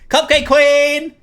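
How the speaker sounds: high, loud, drawn-out hail; excited shout. Real, not acted. Streamer hailing his favorite girl with a loud cry. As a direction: crown her with a shout.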